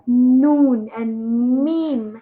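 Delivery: "Noon and meme" very slowly, nasals held; teacher modelling noon and meme; slow letter demo. Shown as a demonstration. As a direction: hum through each letter. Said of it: "should know how to say noon".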